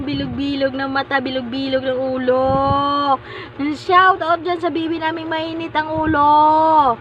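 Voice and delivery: squealed start, then rhythmic singsong announcing; teasing shout-out. Pretend. Parent teasing hot-headed baby girl, singsong shout-out. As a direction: hype up the baby like a playful announcer.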